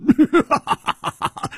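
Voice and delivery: a big, high burst of laughter; booming happy laughter; hearty laugh. A narrator's reading. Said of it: "大笑着说".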